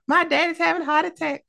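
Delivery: very high, fast mock panic; put-on high voice, frantic and comic; hammed-up panicked wail. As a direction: squeal it in a silly falsetto. Repeats wife's line in high voice.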